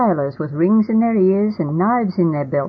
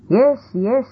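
brisk higher dismissive repeat; impatient; 7 semitones higher than usual. A narrator's reading. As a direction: brush the sailor off quickly. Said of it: "with an impatient wave of his hand".

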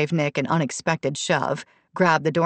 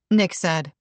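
plain reading voice

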